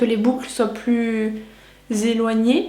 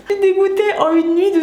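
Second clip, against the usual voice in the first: high dismayed cry; 8 semitones higher than usual. Real, not acted. Disappointed with the result.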